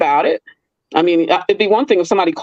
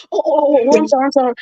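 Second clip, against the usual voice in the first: mock flustered worker, higher; flustered apology; 7 semitones higher, faster than usual. The caught carpet guy apologizing, flustered. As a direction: play the caught man, flustered. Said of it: "he said".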